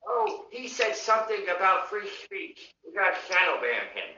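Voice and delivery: mocking nerd voice with a lisp; put-on lisp, poking fun at tech workers. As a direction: mimic a tattling nerd, lisping. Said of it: "they talk like that with a lisp".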